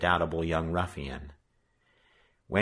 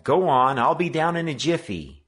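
higher, louder call downstairs; 8 semitones higher, 7 dB louder than usual. A narrator's reading. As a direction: shout it down the stairs. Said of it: "he called".

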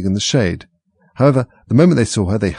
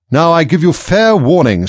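higher, louder furious Queen, slow; shouted; 8 semitones higher, 8 dB louder than usual. A narrator's reading. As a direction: bellow the warning at the Duchess. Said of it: "shouted the Queen".

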